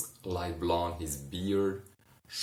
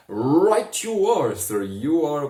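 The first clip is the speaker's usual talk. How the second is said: grand magical visitor; 8 semitones higher, 11 dB louder than usual. Acted. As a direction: boom it out like a stage magician. Somnus greets drunk Ron.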